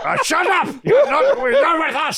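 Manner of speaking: very high, laughing yell; mock shouting. Relatives told off over walkie-talkie, mock shouting.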